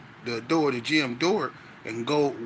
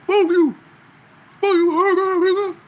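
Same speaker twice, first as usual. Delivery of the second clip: much higher and louder, a put-on strained shout; mocking a deaf man's yell; 11 dB louder than usual. Deaf inmate yelling back at the teller, high mock shout. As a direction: imitate the deaf guy cussing him out, loud and high. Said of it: "he was talking loud".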